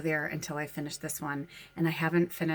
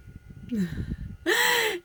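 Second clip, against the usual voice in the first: high giggle. Real, not acted. Laughing about finishing her book.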